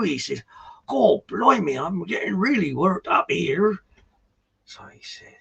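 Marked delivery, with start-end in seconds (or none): 0.9-3.8 worked-up simpleton, pitched high; village idiot. Acted. Village idiot complaining to the farm workers, high and worked up. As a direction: play a hammed-up bumpkin getting flustered, voice raised in pitch. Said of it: "a bit of a village idiot".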